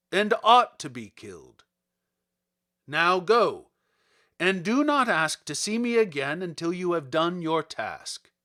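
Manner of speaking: booming wizard Oz. Acted. The great head sets Dorothy's price, loud and commanding. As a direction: lay down the terms like an all-powerful wizard.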